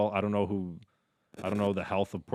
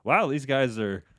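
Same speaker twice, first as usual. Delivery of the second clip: gullible fan gushing; 10 semitones higher, 9 dB louder than usual. Pretend. Fan fooled by press conferences, rising gushing amazement. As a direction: gush like a fan who believes every word. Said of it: "then you're just like".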